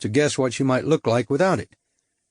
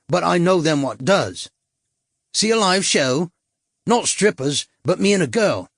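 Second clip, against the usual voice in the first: higher, wheedling London hustler voice; cockney tout; 6 semitones higher than usual. A narrator's reading. Tout selling blue films to the Yank, wheedling cockney. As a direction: reel off the menu like a market trader.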